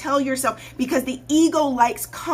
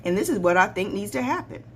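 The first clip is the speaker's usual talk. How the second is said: lower, softer coach voice; calm strategist; 7 semitones lower, 5 dB quieter, faster than usual. Retold or imitated. Coach voicing herself advising a client, low calm. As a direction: lay it out low and measured. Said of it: "be like".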